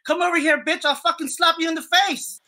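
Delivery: high, pushed retold threat; angry threat. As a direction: snarl it at her as if about to strike. Retelling threatening the customer's wife.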